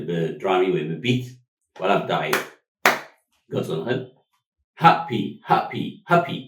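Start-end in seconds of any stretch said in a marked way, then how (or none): 4.8-6.4 hap-py said slowly, beat by beat; syllables tapped out. Shown as a demonstration. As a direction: count the beats in happy. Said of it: "Two sounds".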